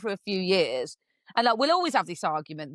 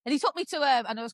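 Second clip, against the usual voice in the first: ordinary talk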